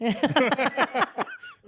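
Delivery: slow, high laughing words; words squeezed out through laughter. Real, not acted.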